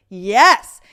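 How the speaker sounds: loud enthusiastic yes; loud burst of approval; emphatic cheer. Retold or imitated. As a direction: cheer him on. Cheering boyfriend's advice.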